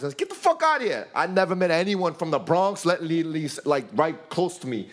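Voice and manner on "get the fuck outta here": high pitched incredulous exclamation; amazed disbelief. Retold or imitated. Telling friends about meeting a Bronx gym guy, high incredulous yelp.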